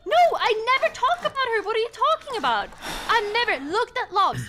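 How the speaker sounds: high, loud, indignant denial; defensive shouting. Real, not acted. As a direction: insist you did nothing, loudly. Heated denial in argument.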